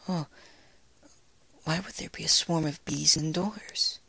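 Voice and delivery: high, breathy timid whisper; timid Fluttershy. A narrator's reading.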